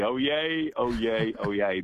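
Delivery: formal crier's "Oh, yay" opening; town crier call. Shown as a demonstration. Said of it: "Be very po-, professional".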